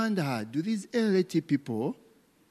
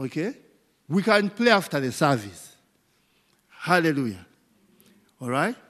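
ordinary talk

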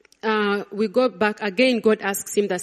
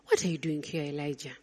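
gentle, soft question; 6 semitones lower, 11 dB quieter, faster than usual. Acted. God gently questioning Elijah.

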